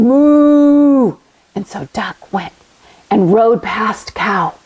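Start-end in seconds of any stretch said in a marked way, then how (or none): 0.0-1.2 drawn-out playful moo; cow moo. Pretend. Teacher mooing for kids in cow pose, drawn out. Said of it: "lift your head and chest".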